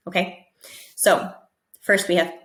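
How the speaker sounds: usual speaking voice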